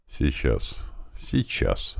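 hushed, held-back waiting; intent repeating. A narrator's reading. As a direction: repeat it softly while taking careful aim. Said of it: "твердил Илья". (тихо, сосредоточенно твердя)

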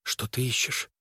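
soft, hushed question; narrated near-whisper, well below full voice. A narrator's reading. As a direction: ask it softly, as if whispering. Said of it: "спросила шёпотом".

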